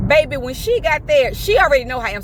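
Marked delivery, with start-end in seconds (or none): none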